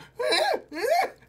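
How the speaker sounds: very high imitated squeak; squeaky warm-up noise. Imitating Gallows warming up his vocals, squeaking. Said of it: "and just squeak".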